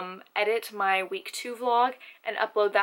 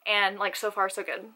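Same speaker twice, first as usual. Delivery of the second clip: normal talking voice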